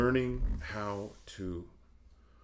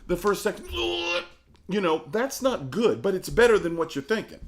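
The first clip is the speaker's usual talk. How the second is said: excited emphasis; 11 semitones higher, 7 dB louder than usual. Real, not acted. Excited point about four seconds.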